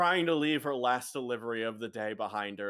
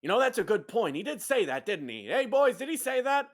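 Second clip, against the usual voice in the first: loud, higher swinging boss voice; animated gang boss; 8 semitones higher, 4 dB louder than usual. Acted. Boss asking his boys about Marco's order, animated. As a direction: check it loudly with the crew.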